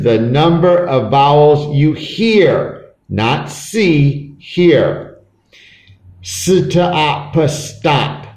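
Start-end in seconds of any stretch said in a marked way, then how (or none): 6.2-8.3 S, t, o, p, then blended stop; sounded out. Shown as a demonstration. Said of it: "How many vowels do you hear?".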